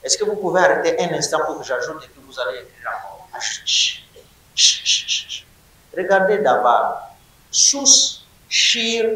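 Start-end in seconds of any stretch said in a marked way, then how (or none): 3.3-5.5 voiceless chut, chut, chut; shushing. Real, not acted. Teacher hushing noisy class. (chut chuchoté pour faire taire la classe)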